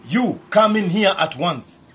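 loud angry summons; angry shout. Acted. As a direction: call them in with fury. Uncle calls them in.

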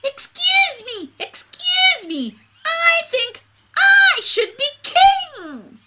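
very high, squeaky little bird; shrill tiny voice. A narrator's reading. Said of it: "a shrill voice".

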